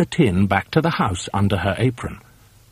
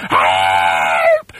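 screaming for help; 5 semitones lower, 4 dB louder than usual. A narrator's reading. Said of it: "screamed Missus Twit".

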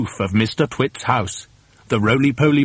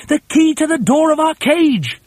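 high, loud insistent shout; urgent cry; 5 dB louder than usual. Acted. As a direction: cry it out impatiently to someone far away. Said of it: "cried Mugglewump".

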